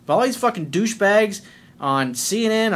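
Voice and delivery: ordinary talk, nothing marked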